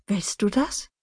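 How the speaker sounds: ordinary delivery, nothing marked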